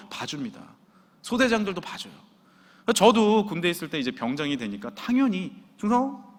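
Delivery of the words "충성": higher-pitched, offhand salute; happy-go-lucky sergeant greeting; cocky casual salute. Retold or imitated. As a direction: breeze past with a careless salute. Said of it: "이렇게 달랑달랑 다녔죠".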